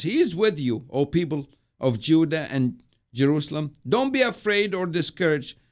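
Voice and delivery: rousing proclamation; preaching the prophecy. Acted. Prophet's words quoted.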